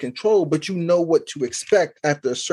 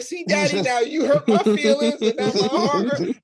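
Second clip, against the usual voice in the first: put-on much higher, whiny little-girl voice, with a laugh at the end; wounded daughter; 6 semitones higher than usual. Daughter blaming dad for hurting her feelings, high whiny voice. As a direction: pout it back at daddy. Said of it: "She like".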